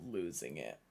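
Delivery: worn-out voice dropping away; voice fading. Real, not acted. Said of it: "I could feel my voice just losing it".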